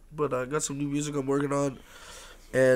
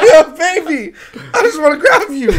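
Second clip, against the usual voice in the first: very high, loud cooing wail; hammed-up adoration; 14 dB louder than usual. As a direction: play overwhelmed by a tiny cub. Acts out his reaction to baby lion.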